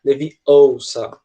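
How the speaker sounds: Leviosa stretched very slowly, with long, stressed vowels; British Leviosa. Retold or imitated. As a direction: lean hard on every vowel of Leviosa. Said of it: "bem enfáticas".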